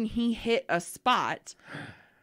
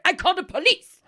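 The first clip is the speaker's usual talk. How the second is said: high, loud, no-nonsense shout; sudden firm shout; 10 semitones higher, 8 dB louder, faster than usual. Retold or imitated. Nail tech finally losing patience with the client, loud high shout. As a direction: snap that the cops are called. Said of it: "went from being very gentle to just like".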